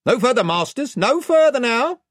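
high, loud warning from an unseen chief. A narrator's reading. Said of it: "a voice spoke out of the air".

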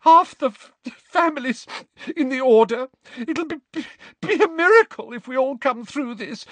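high, stammering, loud crying outburst; Molly sobbing about the Order families; distraught crying. A narrator's reading. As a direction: pour out every fear while sobbing.

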